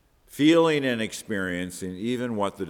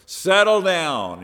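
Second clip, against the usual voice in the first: slow, loud, raised command; commanding the elements; 7 semitones higher, 7 dB louder, slower than usual. Acted. Jesus speaking directly to wind and waves, loud slow command. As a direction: order it firmly, stretching the words. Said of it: "He's speaking directly to the wind".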